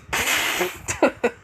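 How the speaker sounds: high, breathy burst of laughter; acted laugh. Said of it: "he goes like this".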